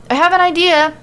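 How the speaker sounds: high, loud eager announcement; excited. Acted. Elias tells everyone his plan, high and excited. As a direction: burst out with the idea. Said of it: "says to everyone excitedly".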